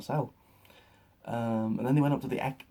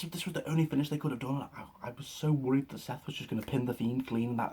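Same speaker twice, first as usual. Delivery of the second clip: normal talking voice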